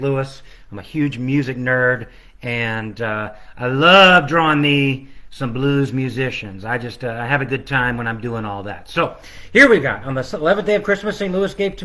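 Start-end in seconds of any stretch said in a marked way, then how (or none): none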